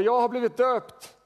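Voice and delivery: loud declaration pitched well up; Martin Luther calling out. Retold or imitated. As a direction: cry it out loudly, imitating the reformer. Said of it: "Och han ropade".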